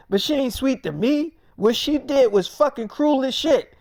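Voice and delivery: high, forceful acted argument; heated objection. Friend objecting that she is cruel, heated retort. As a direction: argue it hard, voice pushed up and loud.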